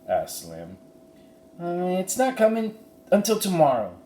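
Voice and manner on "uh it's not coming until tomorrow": put-on quick, much higher girl's voice; Annie quickly. Annie hurriedly covering for the stagecoach, high quick voice. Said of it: "Annie said quickly".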